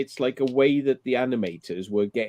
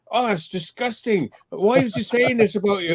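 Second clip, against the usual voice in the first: high indignant gasp of outrage; imitating Zach, scandalised on his behalf; mock outrage; 8 semitones higher than usual. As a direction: play it as scandalised. Retelling Zach's outraged reply.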